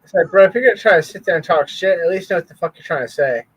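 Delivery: fast rant. Real, not acted. Ranting at clip speaker.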